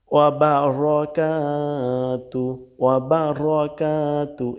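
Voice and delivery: slow, careful Arabic recitation, each syllable drawn out. Shown as a demonstration. Teacher reciting Arabic slowly for learners. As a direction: recite it slowly for students to copy.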